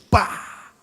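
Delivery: sharp unvoiced spitting noise; mimicking the mother's spit hitting his forehead; spit sound. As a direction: spit it out with a burst of air.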